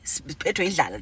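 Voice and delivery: ordinary talk, nothing marked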